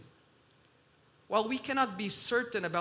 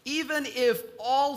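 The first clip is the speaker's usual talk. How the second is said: louder, higher line with "all" hit hard; "all" stressed emphatically, voice raised; 6 semitones higher, 7 dB louder than usual. Shown as a demonstration. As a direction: punch the word "all". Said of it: "I want to emphasize the word all".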